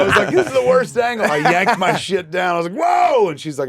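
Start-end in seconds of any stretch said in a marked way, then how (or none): 0.3-1.3 loud. Retold or imitated. Retold panic as shirt yanked. 2.8-3.3 loud high yelp of alarm; startled shout. Retold or imitated. Retold yelp as shirt yanked off.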